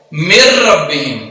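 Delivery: من ربہم slowly with no ن, the ر doubled; n merged into r. Shown as a demonstration. Teacher demonstrates the hidden ن slowly. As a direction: drop the ن and lean on a doubled ر. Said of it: "direct را کو ظاہر کرنا ہے".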